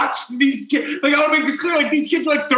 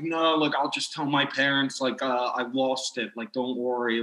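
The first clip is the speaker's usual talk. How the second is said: low, soft, sinking voice; meek retold reply; 9 semitones lower, 8 dB quieter than usual. Robbed kid promising silence to bullies, meekly imitated. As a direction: play a kid giving in, quietly.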